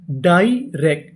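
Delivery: Direct said slowly, syllables joined; slow model reading; slow blend. Shown as a demonstration.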